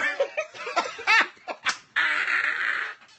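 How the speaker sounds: loud, high, long burst of laughter; real laugh at his own words; big laugh. Real, not acted.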